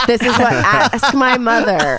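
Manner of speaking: ordinary delivery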